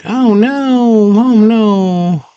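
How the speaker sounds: high-pitched wailed dismay; hammed-up high panic; mock alarm. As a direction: cry it out like a cartoon damsel. Acts out Sarah alarmed by creatures.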